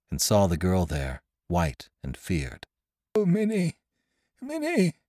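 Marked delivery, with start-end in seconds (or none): 3.1-4.9 thin, very high dying cry; frail, high-pitched last call; dying man. Acted. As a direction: call to her weakly, high and soft. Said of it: "cried the dying man".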